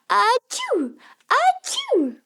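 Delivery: high pretend sneezes. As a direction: fake sneezing. Acting out sneezes.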